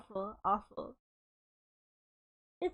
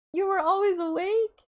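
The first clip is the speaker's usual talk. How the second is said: high, loud startled yelp; shocked cry; 8 semitones higher, 6 dB louder than usual. Acted. Brother stunned to learn his sister was awake, yelping. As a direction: blurt it out in horror.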